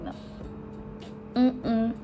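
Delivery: ordinary talk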